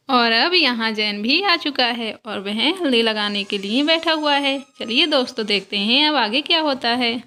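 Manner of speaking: calm narrator telling the doll story; story narration. A narrator's reading. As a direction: step out of the scene and narrate for the viewers. Narrator links the doll scenes.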